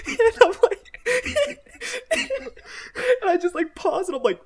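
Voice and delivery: high, loud laughter breaking through the words; can barely get the words out for laughing; laughing hard. Real, not acted. Telling the punchline to his cohost, laughing so hard it squeaks. Cracking up at own story.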